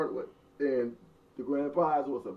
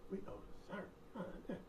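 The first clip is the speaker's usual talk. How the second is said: breathy laughing aside; 6 semitones higher, 21 dB quieter, much faster than usual. Real, not acted. Laughing at their age.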